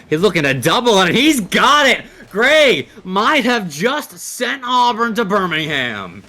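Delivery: ramp up into the decisive goal; excited goal call. Real, not acted. Caster calls winning goal.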